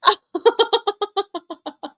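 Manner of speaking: high giggling laugh trailing off; real laugh. Real, not acted. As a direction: burst into a long, high giggle. Bursts out laughing at chat.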